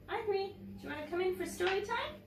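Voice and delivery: high, quick call to a cat. Real, not acted. Teacher calls her cat Ivory in for story time, high quick call. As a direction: coax the cat in brightly.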